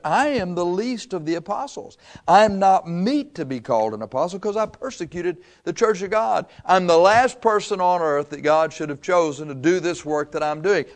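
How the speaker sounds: a lightly voiced Paul, pleading unworthiness; voicing Paul. Preacher voicing Paul to the congregation, pleading.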